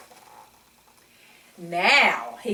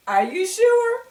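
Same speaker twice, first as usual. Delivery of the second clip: ordinary delivery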